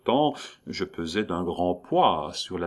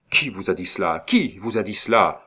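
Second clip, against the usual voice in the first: a demand repeated, more insistent the second time; alarmed stammered question; 5 dB louder than usual. A narrator's reading. Sick count to his doctor who revealed poisoning, alarmed. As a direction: livid patient's urgent question. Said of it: "il balbutiait par deux fois".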